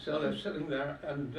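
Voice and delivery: ordinary talk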